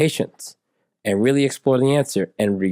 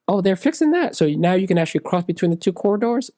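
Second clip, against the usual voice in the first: pleasantly surprised; 9 semitones higher than usual. Acted. Acted friendly airport small talk.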